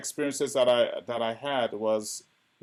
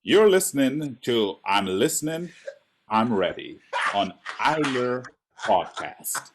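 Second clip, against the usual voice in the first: radio announcer; 5 dB louder, slower than usual. Acted. Podcast station ident.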